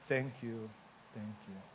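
very low, hushed thanks; subdued mourner. Acted. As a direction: accept condolences quietly, fading almost to nothing. Widow accepting condolences in joke.

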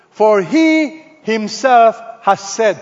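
HE HIMSELF drawn out slowly and loud; emphatic. Shown as a demonstration. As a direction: lean hard and slow on "he himself". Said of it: "This is what we call emphatic".

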